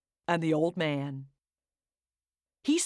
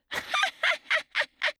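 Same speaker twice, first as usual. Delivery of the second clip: high teasing laugh; gleeful cackle. Acted. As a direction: laugh back at the chasers. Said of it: "and laughed".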